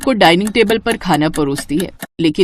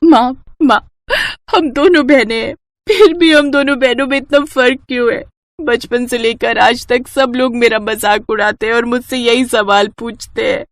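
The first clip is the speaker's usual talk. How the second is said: high, pleading, close to tears; tearful plea; 4 semitones higher than usual. Acted. Hurt daughter crying to her mother about being mocked, tearful. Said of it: "वो रोने लगती है।".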